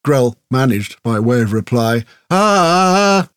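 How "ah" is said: sharp high cry of pain. A narrator's reading. Grell pinned by the sword, crying out in pain. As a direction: yelp as the sword pins you. Grell speared through the foot.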